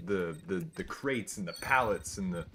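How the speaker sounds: usual speaking voice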